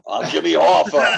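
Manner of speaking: loud, raspy Pacino yell; Pacino as Hoffa. Retold or imitated. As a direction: belt it like Pacino playing Hoffa. Echoing the Pacino impression.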